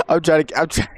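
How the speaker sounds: ordinary talk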